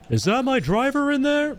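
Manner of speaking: high, hammed-up anguished cry; movie-quote parody. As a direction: wail it like a melodramatic film line. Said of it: "we like saying stupid shit from movies".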